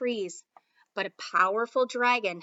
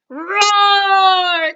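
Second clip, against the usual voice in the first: huge, much higher and louder roaring cry; dragon roar; 8 semitones higher, 17 dB louder than usual. Acted. Dragon roars in battle.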